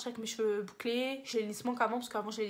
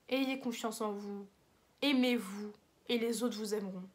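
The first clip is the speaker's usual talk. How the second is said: slow, deliberate sign-off advice; measured closing motto; slower than usual. Pretend. Vlogger giving viewers her last line, slow and solemn. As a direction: deliver it slowly, like a motto.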